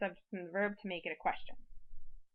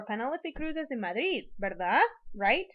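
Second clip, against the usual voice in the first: "verdad" and "Right" louder, rising; tag question; 2 semitones higher, 4 dB louder than usual. Shown as a demonstration. Teacher models confirming a statement, questioning tag. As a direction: state it, then lift "verdad" and "Right" to check. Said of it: "trying to confirm whether or not".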